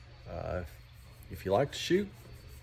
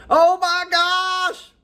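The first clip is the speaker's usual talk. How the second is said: much higher, much louder, slow drawn-out gasp of shock; shocked shout; 12 dB louder, much slower than usual. Retold or imitated. Joke pilot yelps to passengers over hot coffee, loud shocked cry. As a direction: gasp it out stretched and loud, like scalding coffee just hit.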